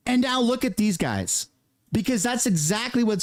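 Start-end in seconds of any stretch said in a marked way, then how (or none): none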